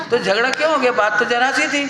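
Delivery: usual speaking voice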